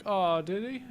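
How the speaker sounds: high, innocent mock surprise; feigned surprise. Husband pretending to his wife he knew nothing, feigned surprise. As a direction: play innocent with a rising lilt.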